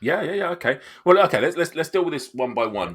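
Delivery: flustered. Real, not acted. Game master flustered by the player's odd request. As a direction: scramble to regain control. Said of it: "DM overwhelmed".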